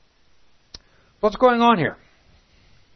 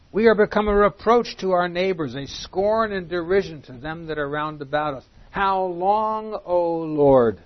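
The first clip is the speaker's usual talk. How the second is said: raised, solemn reading of the psalm; scripture reading; 3 semitones higher, 4 dB louder than usual. Acted. Preacher reads a psalm aloud to his class, solemn and raised. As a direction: read the lament out to the congregation.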